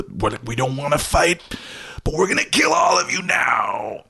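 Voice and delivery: mock war cry, rising high; mild threat building to a strained yell; warrior monks. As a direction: voice a warrior monk's battle shout. Voices warrior monks threatening to kill.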